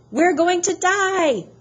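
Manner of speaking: very high, slow hammed-up panic cry; panicked yelling. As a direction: wail like frantic passengers. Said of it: "running around in circles yelling".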